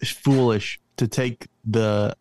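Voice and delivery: slowed right down to make the point; slow, emphatic insistence. Real, not acted. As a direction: lay it out word by word, firmly. Insisting on his pick.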